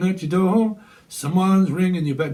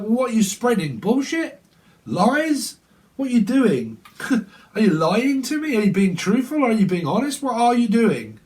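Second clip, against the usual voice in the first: mock accusing questions; 6 semitones higher than usual. Acted interrogation of partner.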